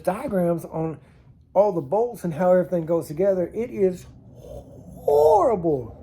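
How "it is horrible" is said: drawn out. Real, not acted. Dragging out exasperation.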